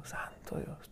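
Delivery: real awe, whispered under the breath; awed whisper. Real, not acted. Preacher overcome by the verse, whispering in awe. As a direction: let it slip out as a hushed prayer.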